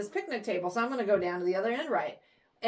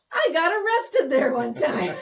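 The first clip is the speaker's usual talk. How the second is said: laughing; 6 semitones higher, 5 dB louder than usual. Retold or imitated. Retold man's surprising punchline.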